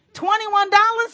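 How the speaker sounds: disbelief. Acted. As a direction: scoff at how small the sum is. Disbelief at the small sum.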